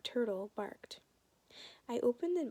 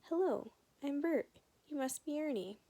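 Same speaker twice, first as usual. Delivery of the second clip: muppet voice; 6 semitones higher than usual. Acted. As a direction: play Bert the puppet, chirpy. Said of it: "yapping away".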